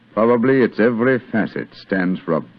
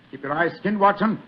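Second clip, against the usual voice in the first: rally your partner into the chase; excited shouts urging a friend after the fleeing villain; urgent calls; 12 semitones higher than usual. Acted. Chase as lights go on.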